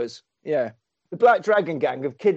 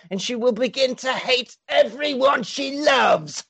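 sixties villain voice; 5 semitones higher than usual. Acted. Fu Manchu gloating over drugged Charlotte in a sixties villain voice. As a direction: play a hammy sixties film baddie. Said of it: "I'll do it in the sixties voice".

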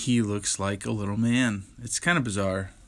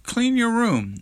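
stern parent; 13 semitones higher, slower than usual. Retold or imitated. Voicing a parent's chore command.

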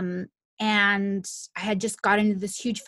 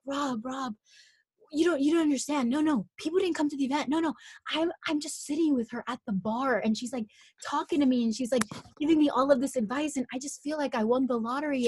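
higher, hushed urgent excitement; reliving a giddy, disbelieving call; excited phone call; 5 semitones higher than usual. Retold or imitated. Acts out excited call to boyfriend.

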